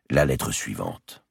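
usual speaking voice

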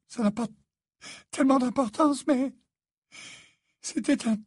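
very high, halting lament; broken phrases in a high, weepy voice; tearful mother. A narrator's reading. Mother crying over her disfigured son, tearful and halting. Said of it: "Elle se mit à pleurer".

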